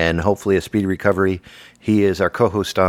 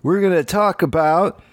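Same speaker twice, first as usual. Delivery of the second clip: wannabe broadcaster parody; 5 semitones higher than usual. Pretend. Parodying amateur podcasters, overeager hammed-up host voice. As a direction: ham up an overexcited podcast opener. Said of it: "we're gonna show you what they were like".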